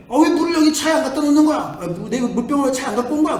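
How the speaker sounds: high, loud annoyed whine of a sulky son; mimicking a grumpy teenager who is fed up; irritated whine. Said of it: "아주 짜증나게".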